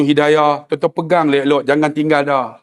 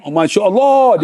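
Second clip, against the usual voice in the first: ordinary talk, nothing marked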